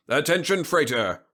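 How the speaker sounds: loud, high official radio call; blaring radio hail. A narrator's reading. Patrol boat hailing an intruding freighter, blaring. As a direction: blast the hail out over the radio. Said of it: "the radio blasted".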